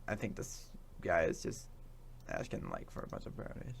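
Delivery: breathy half-whisper to himself. Real, not acted. Whispering while the video plays. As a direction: murmur it under the breath.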